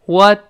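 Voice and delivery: "What" cut short, the t held without a burst; unreleased final t. Shown as a demonstration. Teacher demonstrates lost plosion, "What" with unreleased t. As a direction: stop "What" on a silent t, loud and high. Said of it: "那个t失去爆破了".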